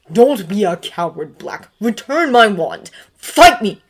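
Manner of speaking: loud hissed demand; angry challenge. A narrator's reading. Severus furiously demanding a fight from Sirius. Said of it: "Severus hissed again".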